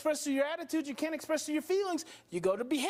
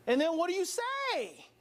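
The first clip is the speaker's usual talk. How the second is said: ordinary delivery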